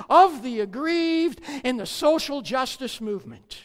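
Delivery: loud, fast, fervent preaching; preaching at full force. Acted. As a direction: drive the point home to the congregation.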